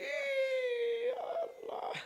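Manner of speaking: high, strained wail to God; mock agonised cry, stretched and thinning away; hammed-up moan of someone hurting; crying out in pain. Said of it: "তখন কতি আর কয়".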